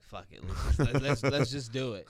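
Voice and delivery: reckless resolve. Retold or imitated. Retelling giving in to pizza.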